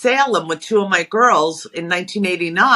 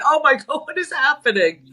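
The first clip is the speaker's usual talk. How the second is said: high, laughing disbelief; amazed laugh; 5 semitones higher than usual. Retold or imitated. Meets twin sisters, laughing amazed disbelief. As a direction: react to twins with laughing astonishment. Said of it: "I said".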